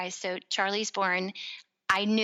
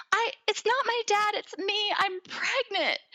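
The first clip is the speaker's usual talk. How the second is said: high-pitched mock wail, slowing at the end; retold bawling; 13 semitones higher than usual. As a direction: act out bawling to a best friend, pitch way up. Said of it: "like, bawling".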